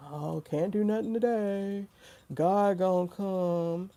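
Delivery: slow, mock-weary drawl of a resigned believer; lightly voiced defeatist; mocking the defeated. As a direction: droop the words like someone who has given up.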